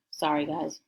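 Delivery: ordinary delivery, nothing marked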